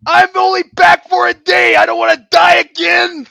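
loud, high, desperate cry; panicked shout. Acted. Wrestler ghost begging the others, shouting in panic. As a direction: plead at full volume. Panicked pleading after scare.

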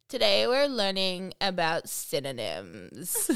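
slow, drawled LA vocal fry; Kardashian accent. Retold or imitated. Teacher reading instructions to students, Kardashian accent. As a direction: drag it out, creaking at the end. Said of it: "out in the Kardashian accent".

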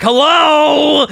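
high, loud mock greeting; mock shout. Pointing out the name on the coffin, loud mock hello. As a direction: yell it like an obvious answer. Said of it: "It's like".

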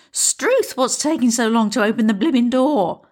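put-on high, exasperated old lady; exasperated Millie. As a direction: exclaim it impatiently. Millie voiced, exclaiming.

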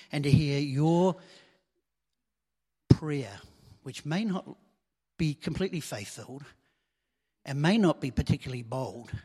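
ordinary delivery

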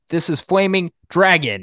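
mild mock yell. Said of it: "like, yelling".

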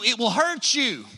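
ordinary delivery

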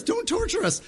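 very high squealing demon begging. Acted. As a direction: push the plea way up into a squeal. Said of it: "how the demons were begging Jesus".

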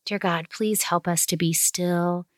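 quiet family prayer. Acted. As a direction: pray gently and unhurried. Opens the closing prayer.

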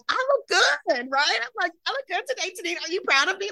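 very high, exaggerated eager plea; mock validation-seeking. Client asking facialist for praise, high needy mock voice. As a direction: squeak hopefully for approval, hamming it up. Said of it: "I'm just looking for a validation".

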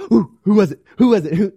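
grab the phone in a rush; frantic who was it. Pretend. Churchgoer jumping at a text ding, frantic.

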